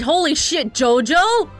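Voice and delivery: high, loud delighted outburst; excited exclamation. Real, not acted. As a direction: blurt it out thrilled. Excited reaction to pose.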